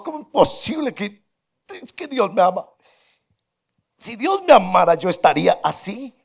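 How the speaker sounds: high, loud outraged protest; shouting in protest. Retold or imitated. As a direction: yell it as an offended street man would. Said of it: "empezó a vociferar frente a todos".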